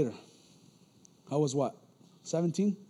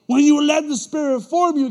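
repeated line, louder and higher; louder preaching; 8 semitones higher, 11 dB louder than usual. Shown as a demonstration. Preacher repeating the point to the congregation, loud. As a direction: hit it hard the second time. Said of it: "repeated louder".

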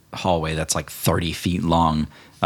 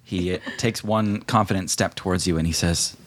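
normal talking voice

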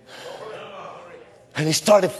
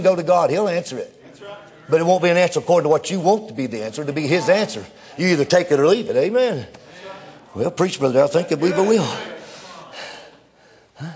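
normal talking voice

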